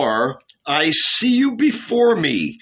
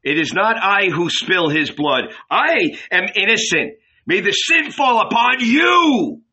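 raised pitch climbing to a loud cry; public declaration building to shout; 8 semitones higher, 4 dB louder than usual. A narrator's reading. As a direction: disown the blame, ending in a shout. Pilate declares innocence to crowd.

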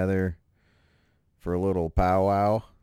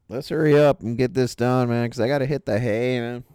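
loud and hurried; 6 semitones higher, 9 dB louder, faster than usual. Real, not acted. Urging to hurry.

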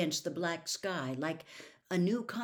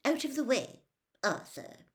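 terse, icy order; cold, curt; 6 semitones higher than usual. A narrator's reading. Ministry official brushing Arthur aside, cold and curt. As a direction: order someone aside curtly and coldly. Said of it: "said a cold, curt voice".

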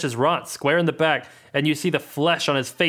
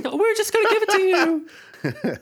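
squeaky voice; slower than usual. Acted. GM voices laughing character.

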